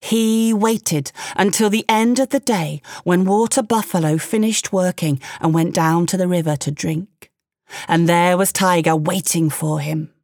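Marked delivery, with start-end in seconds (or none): none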